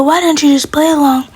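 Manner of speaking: ordinary talk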